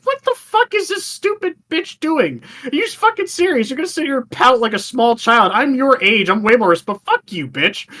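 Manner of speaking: high, incredulous mock outrage; exasperated rant. Ranting at the spoiled princess, high mock outrage. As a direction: squeal your disbelief at her. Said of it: "I'm like".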